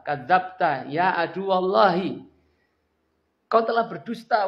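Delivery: loud high furious rebuke, slow Arabic opening; angry retort. Acted. Umar answering Abu Sufyan, angry retort. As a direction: hurl the accusation back. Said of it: "nggak mampu menguasai dirinya".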